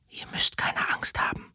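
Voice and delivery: soft, gentle whisper to the scrolls. Acted. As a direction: hush them like frightened children. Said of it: "flüsterte die alte Frau".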